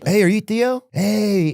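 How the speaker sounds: high-pitched fan greeting; eager fan. Retold or imitated. Fan spotting the comedian, high excited hail. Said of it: "A lot of them would be like".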